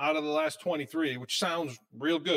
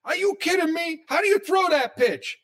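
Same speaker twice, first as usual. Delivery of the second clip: much higher and louder, mock outrage at the pitcher; high-pitched mock rant at a bad pitch; imitating a yelling TV fan; 14 semitones higher, 5 dB louder than usual. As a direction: ham up a fan screaming at the screen. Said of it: "I hear him yelling at the T V".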